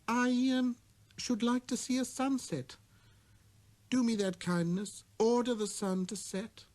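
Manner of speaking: put-on high, shy child's request; little prince. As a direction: ask the favor timidly.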